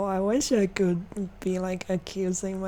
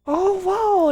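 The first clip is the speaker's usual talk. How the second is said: higher, louder gasp of disbelief; shocked disbelief; 10 semitones higher, 8 dB louder than usual. Real, not acted. Streamer reacting to the bullies' story, stunned disbelief. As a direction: blurt it out, stunned by what they did. Reacts to game characters' confession.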